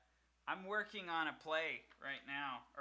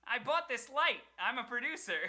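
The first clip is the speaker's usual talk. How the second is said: mock proud brag; 9 semitones higher, 8 dB louder than usual. Joking brag about self-producing.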